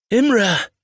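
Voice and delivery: high, loud anguished scream; panicked shout into the storm. A narrator's reading. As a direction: scream the name in despair. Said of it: "he screamed again".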